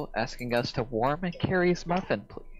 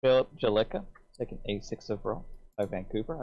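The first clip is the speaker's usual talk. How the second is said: ordinary talk, nothing marked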